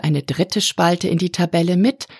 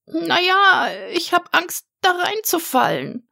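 hohe, helle Drachenstimme, ängstlich; kleiner Drache; 13 semitones higher than usual. A narrator's reading. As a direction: spricht als kleiner, verzagter Drache. (hohe Stimme des kleinen Drachen, der Max seine Angst gesteht)

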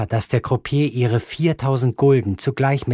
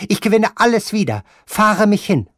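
high, fast, loud command; feverish grandmother; 10 semitones higher, 5 dB louder than usual. Acted. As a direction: rush out the order like a gambler possessed. Grandmother voiced in the story.

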